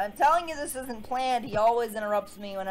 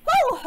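high, loud woo; sharp excited whoop; hyped whoop; 11 dB louder than usual. Real, not acted. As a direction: let out a quick pump-up whoop. Psyching up before retake.

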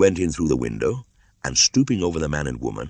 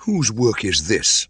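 angry demand; 7 semitones higher, 6 dB louder, slower than usual. Acted. Mowgli sees her blood.

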